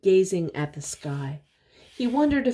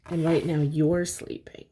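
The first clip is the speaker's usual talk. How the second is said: plain reading voice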